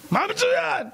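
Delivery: high-pitched, astonished question; incredulous. Acted. Father questioning his son in the icy water, incredulous. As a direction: throw it back in disbelief, pitch leaping.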